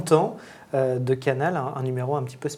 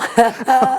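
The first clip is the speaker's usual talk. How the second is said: high burst of laughter; laughing; 9 semitones higher, 6 dB louder than usual. Real, not acted. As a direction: laugh out loud. Host laughs at quadra sexy.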